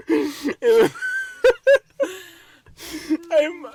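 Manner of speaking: high, loud laugh-crying; wheezing with laughter; helpless laughter. Real, not acted. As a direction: lose it laughing. Cracking up mid-story.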